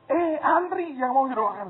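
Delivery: loud, high, drawn-out announcement; acted calling out. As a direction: call the crowd to line up, stretching it out. Calling people to queue for prayer. (seruan memanggil antrean, keras, tinggi, dipanjangkan)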